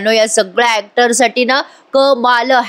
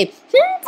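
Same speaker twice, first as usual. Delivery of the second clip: a knowing little hum; a sly hum with the pitch shooting way up; amused hum; much slower than usual. Real, not acted. Narrator reacts to the scene.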